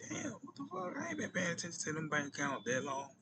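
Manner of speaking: high-pitched what the fuck; mock stunned outcry; shocked disbelief. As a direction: squeal it in disbelief. Retold shock at closed accounts.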